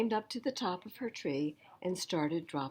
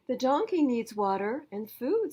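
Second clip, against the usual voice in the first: higher, louder busy sister; sister's voice; 7 semitones higher, 7 dB louder than usual. Acted. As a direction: call it out mid-chore. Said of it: "Sister replied".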